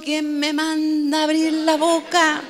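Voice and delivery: slow, strained inner lament; mock regret. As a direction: drag it out like someone regretting their big mouth. Pedro arrepentido, pensando. (lamento fingido, lento y forzado)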